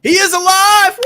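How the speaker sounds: high, loud delighted cry; excited shout. Real, not acted. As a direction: shout it out with joy. Missing friend finally joins stream.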